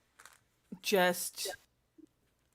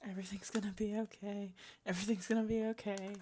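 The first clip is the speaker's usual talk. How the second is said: fast, softer murmured mantra; repeated whisper; 6 dB quieter, faster than usual. Acted. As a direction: whisper it quickly to yourself. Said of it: "whispering to himself".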